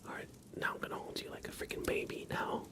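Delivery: usual speaking voice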